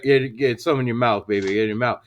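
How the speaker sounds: low, softened mock dirty talk; deep, retold bedroom murmur. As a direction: imitate him coaxing in a deep voice. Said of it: "he's like".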